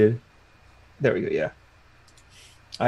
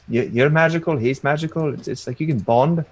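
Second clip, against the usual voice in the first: normal talking voice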